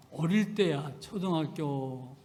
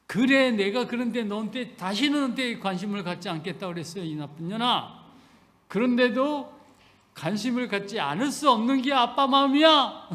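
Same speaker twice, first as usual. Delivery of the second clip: raised voice, louder, pleading; loud heartfelt father; 5 semitones higher, 6 dB louder than usual. Retold or imitated. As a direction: swing from teasing scold to open love. (큰 소리로 진심을 말하는 아빠)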